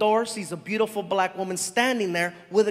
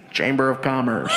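much lower mock official voice; fake official voice; 8 semitones lower than usual. Prankster answering at the door, low mock official voice. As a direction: disguise your voice.

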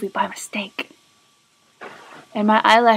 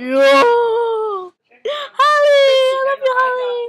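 loud high-pitched fangirl squeal; Sarah; 13 semitones higher, 10 dB louder than usual. Acted. Impersonating a friend screaming love at Holly and Melissa, squealed impression. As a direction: shriek adoringly, dragging out the names. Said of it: "that was my impression of Sarah".

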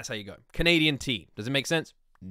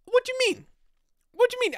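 mock indignant; faster than usual. Mock outrage continues.

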